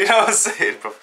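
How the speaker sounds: high-pitched, through laughter; laughing. Real, not acted. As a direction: let a laugh push the voice up high. Laughing over mum's interruption.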